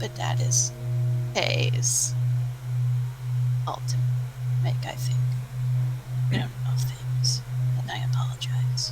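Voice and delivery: whispering. Real, not acted. Whispered aside about another game.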